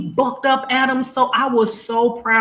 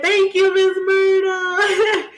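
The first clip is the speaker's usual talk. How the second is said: drawn-out excited greeting; 7 semitones higher, slower than usual. Real, not acted. Greeting a viewer, a slow high happy call. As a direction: stretch the name out in a happy call.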